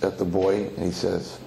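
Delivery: usual speaking voice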